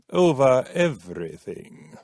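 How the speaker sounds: plain reading voice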